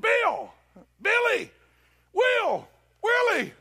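very high, loud street shouts; imitating a man shouting after someone; hollering. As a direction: holler each name separately to get attention. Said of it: "a guy hollered".

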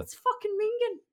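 high, loud Irish-accented Mingen. Shown as a demonstration. Reading Irish slang to cohosts, high loud Irish accent. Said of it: "Say it again, say it again".

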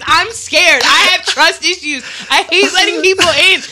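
loud, heated confession rant; mad rant. Real, not acted. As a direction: vent it all out, half laughing. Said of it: "but I'm mad".

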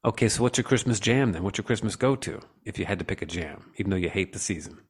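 ordinary talk, nothing marked

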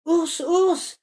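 high, loud call; squirrel calling the bear. A narrator's reading. Squirrel calling the sleepy bear, high and loud. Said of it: "dit l'écureuil".

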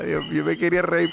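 normal talking voice

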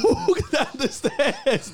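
high drawn out mock line; mock dramatic. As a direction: stretch it slow for laughs. Hammed up repeat, slow.

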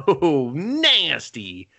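disgusted ew. Retold or imitated. Mimics viewer's disgusted reaction.

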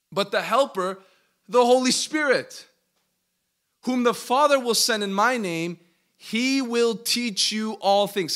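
loud, high, preaching delivery; emphatic scripture reading. Real, not acted. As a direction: proclaim each phrase of the verse forcefully. Reads scripture with preaching force.

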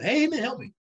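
mock plea for help. Man in hole calling out.

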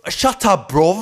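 high, loud British-slang comeback; mock British English. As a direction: re-enact yelling back as a Brit. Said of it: "että mä olin britti".

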